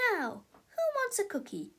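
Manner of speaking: high brittle cheer; forced cheerful teacher. A narrator's reading. Miss Ribble changing the subject, forcedly cheerful. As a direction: pretend all is fine. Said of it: "said Miss Ribble".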